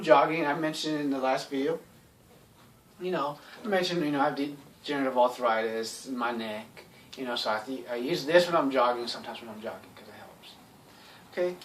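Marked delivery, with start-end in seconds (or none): none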